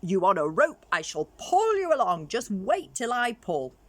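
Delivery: Chameleon calling to Crocodile from the branch. Acted. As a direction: boast to Crocodile across the water.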